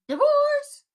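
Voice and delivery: very high, loud, stretched divorce; divorce, yelled high and drawn out; shouted punchline. Real, not acted. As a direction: belt the word out like a verdict. Punchline verdict on the couple.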